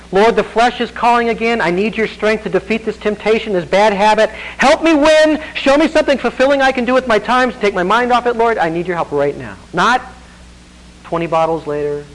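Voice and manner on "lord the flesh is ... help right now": pleading prayer, higher and louder; urgent prayer. Retold or imitated. Believer resisting temptation, pleading loudly with the Lord. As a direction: cry out to the Lord for help. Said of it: "it's the walk now".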